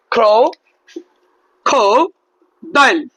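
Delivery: ক্রো, খো, ডাইল each alone with pauses; three syllables. Shown as a demonstration. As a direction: count the beats out one by one. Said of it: "তিনটা খণ্ডে উচ্চারণ". (তিন ভাগে থেমে থেমে)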